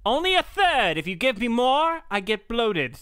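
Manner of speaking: a fussy animal complaining; cow voice. Pretend. Answering as the cow.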